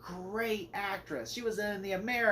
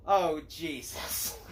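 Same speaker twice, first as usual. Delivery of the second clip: exasperated groan; 3 semitones lower, much slower than usual. Retold or imitated. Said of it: "I'm like".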